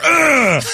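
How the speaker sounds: loud high pleasure grunt; lusty grunt. Acted. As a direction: grunt as you grab. Groping grunt.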